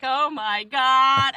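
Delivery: slow, loud, high gasp of awe; star-struck gush, drawn out; freaking out. Retold or imitated. Fan meeting her idol, slow loud high gush. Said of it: "I was freaking out".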